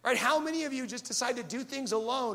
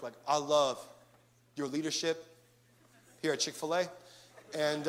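butter up the boss, lightly; mock suck-up employee; 7 semitones lower than usual. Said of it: "you ever try to butter up your boss".

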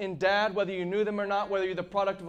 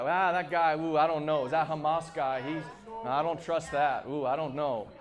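low-pitched mock hesitation; wary churchgoer; 5 semitones lower than usual. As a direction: imitate someone doubtful and suspicious. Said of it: "We'd stumble over that".

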